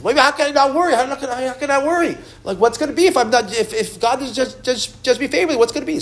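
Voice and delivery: panicky pleading. Retold or imitated. The frightened man protests to Shlomo that he cannot stop worrying, panicky. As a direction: let the panic spill out, pitch climbing. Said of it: "he's very nervous".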